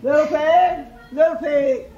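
loud, forceful wolf voice; hungry wolf. A narrator's reading. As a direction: call out like a wolf eager for his meal. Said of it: "licked his lips and said".

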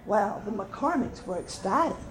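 plain reading voice

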